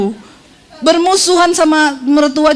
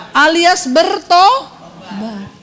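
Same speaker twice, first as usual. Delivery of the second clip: slow emphasis; 3 semitones higher, much slower than usual. Shown as a demonstration. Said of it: "jadi kuncinya".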